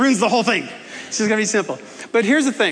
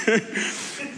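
soft laugh; 6 dB quieter than usual. Real, not acted. Laughing at own joke.

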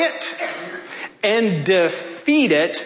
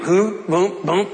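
thump out the heartbeat; mock heartbeat boom; heartbeat sound; slower than usual. Imitating heartbeat restarting.

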